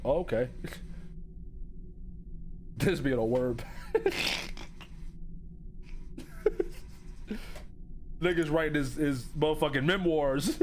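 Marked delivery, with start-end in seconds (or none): 0.5-2.7 quiet, voiceless real laughter; soft laugh. Real, not acted. Reader amused by the writer's plea for help, laughing softly. 3.7-8.2 long, breathy wheeze of laughter; wheezing laugh. Real, not acted. Reader cracking up at the memoirs, wheezing with laughter.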